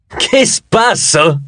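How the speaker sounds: high, very drawn-out silly amusement; silly mirth. A narrator's reading. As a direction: play it as a giggly fool. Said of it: "Rise scioccamente".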